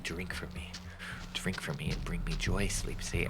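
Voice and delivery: soft, hushed coaxing; the can begging to be drunk, hushed; whispering coffee-can man. Retold or imitated. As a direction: tempt quietly like a talking can. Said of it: "He's like".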